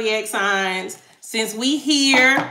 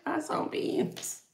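muttered repeat; 6 semitones higher, 11 dB quieter, slower than usual. Shown as a demonstration. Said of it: "these questions".

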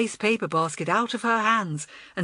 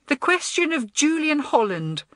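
self-important; 8 semitones higher, 3 dB louder than usual. A narrator's reading. Head girl grandly resumes the meeting.

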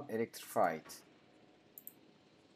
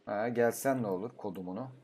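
ordinary talk, nothing marked

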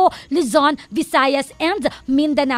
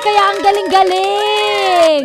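louder, higher, slowed admiring gush; excited; 5 semitones higher, 6 dB louder than usual. Real, not acted. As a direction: marvel out loud, stretching it. Amazed the director was Diego. (namamanghang malakas na boses)

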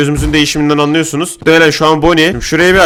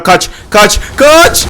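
frantic yell; 5 semitones higher, 4 dB louder, much slower than usual. Real, not acted. Fleeing animatronic in game.

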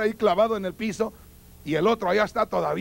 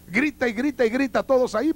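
ordinary talk, nothing marked

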